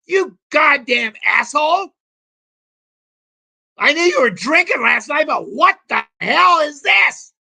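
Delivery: wife screaming at her husband over a worthless IOU, furious. Retold or imitated. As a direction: yell it like an enraged wife holding an IOU. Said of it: "And she'd go".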